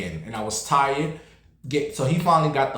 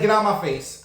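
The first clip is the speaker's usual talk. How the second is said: sharp, higher retold rebuff; mock angry snap; 8 semitones higher than usual. To a pushy man at Pride, a higher angry snap. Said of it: "I said".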